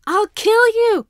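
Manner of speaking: high, loud, slow playful shriek; yelling. A narrator's reading. Foam-smeared boy yells at his teacher, shrieking. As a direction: shriek a mock threat. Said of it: "Katsuki yells".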